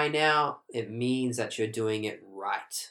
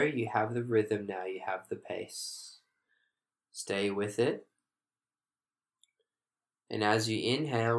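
guided meditation. Acted. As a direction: ease them gently into the rhythm. Guides breathing, soft and calm.

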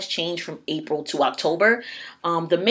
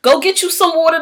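much higher and louder call; loud urging; 11 semitones higher, 9 dB louder than usual. Real, not acted. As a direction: shout it out to the listeners, high. Urging listeners to drink water.

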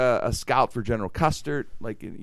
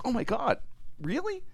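higher, startled "really?" rising sharply; mock surprise; 13 semitones higher, 6 dB quieter than usual. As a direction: act out your own shocked reaction. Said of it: "I'm like".

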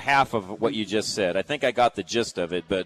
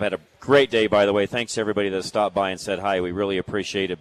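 normal talking voice

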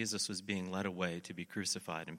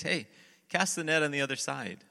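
loud, raised-pitch holler; yelled call; 7 semitones higher, 8 dB louder than usual. Retold or imitated. Jesus yells from shore to the fishermen, yelled call. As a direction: call an instruction out to a distant boat. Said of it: "Jesus yells out at them".

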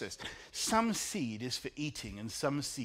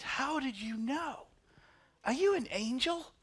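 much higher, slow awed question; amazed question; 11 semitones higher than usual. Retold or imitated. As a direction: ask in wide-eyed wonder. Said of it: "She said".

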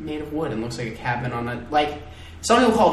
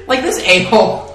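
ordinary delivery

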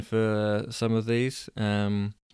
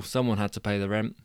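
usual speaking voice

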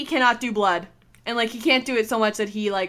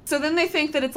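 ordinary talk, nothing marked

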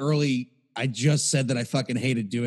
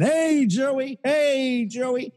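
much higher sing-song call of his name; calling out greeting; 10 semitones higher, much slower than usual. Retold or imitated. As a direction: hail him like an old buddy across the room. Old guys greeting a coworker.

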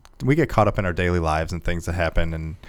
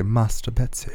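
British accent; 2 semitones higher, 3 dB quieter, slower than usual. Shown as a demonstration. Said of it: "I have to use a British accent".

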